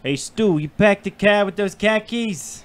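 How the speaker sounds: dropped-r Boston question; Boston accent. Acted. Boston local asking Stu about car keys, Boston accent. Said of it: "for a Boston accent".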